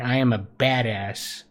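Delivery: ordinary delivery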